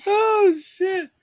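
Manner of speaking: high, loud shriek of laughter on the words; real laughing outburst; laughing exclamation. Real, not acted. Laughing out loud at the absurd movie scene.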